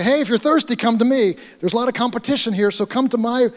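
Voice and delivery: high mock hawker's call. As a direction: play at drumming up a crowd. Imagines calling out to festival crowd.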